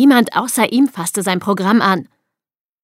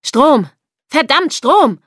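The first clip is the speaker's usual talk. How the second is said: louder, higher frantic demand; urgent shout; 6 semitones higher, 5 dB louder, slower than usual. A narrator's reading. Ed demands power in the blackout, urgent shouting. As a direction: call out urgently, cursing.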